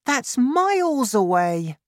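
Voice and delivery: put-on high, drawn-out child's exclamation; high-pitched childlike dismay; crying out. A narrator's reading. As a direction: exclaim slowly as an astonished boy. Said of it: "I cried".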